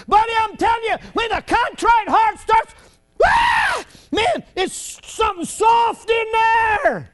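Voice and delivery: high, loud, ringing preacher's shout; full-force preaching. Acted. Preacher to his congregation, shouting high and loud. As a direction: rise up and ring it out like a revival preacher.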